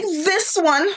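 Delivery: high, hammed-up accusing cry; dramatic Esther. Preacher as Esther accusing Haman before the king, mock dramatic. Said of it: "I'm making it dramatic".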